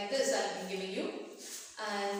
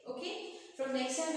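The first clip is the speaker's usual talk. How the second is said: normal talking voice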